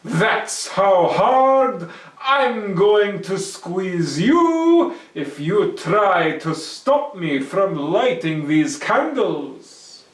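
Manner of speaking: loud, slow mock threat; menacing Herschel. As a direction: squeeze the words out like the egg.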